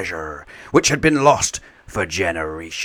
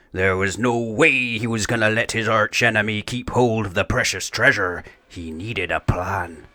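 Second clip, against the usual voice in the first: ordinary delivery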